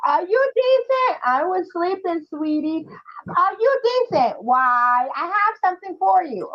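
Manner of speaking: put-on high, sweet little boy asking at the door; imitating polite boy. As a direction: play the nephew checking before he comes in.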